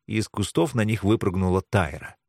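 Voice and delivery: usual speaking voice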